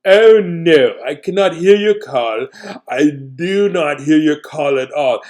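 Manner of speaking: loud, slow answering caller. Acted. Caller on the line answers loudly, a hint of a character. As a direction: shout back that you can't hear. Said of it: "Let's do a voice for that person".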